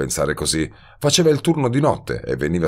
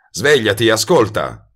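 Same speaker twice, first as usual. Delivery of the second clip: louder, urging her to wake up; urgent call; 4 semitones higher, 5 dB louder than usual. A narrator's reading. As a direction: snap her awake with a firm call. Shaking drugged woman awake. (Sveglia, più forte, per scuoterla)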